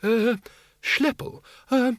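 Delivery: high, hesitant quaver; timid, high-pitched request. A narrator's reading. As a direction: ask nervously in a high, halting voice. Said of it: "Windle quavered".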